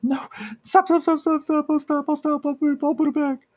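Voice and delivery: very high, frantic begging; shrill panicked repeated stop; desperate pleading. Acted. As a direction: plead in terror so the pain stops. Woman begs as creature shrieks.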